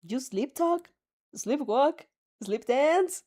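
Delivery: ordinary delivery, nothing marked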